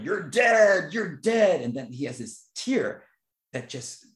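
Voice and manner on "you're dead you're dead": loud, higher anguished shout; grieving vampire yell. Retold or imitated. Vampire shouting at the Corey who killed his friend, loud anguish. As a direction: yell it at the killer through the grief. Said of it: "starts going".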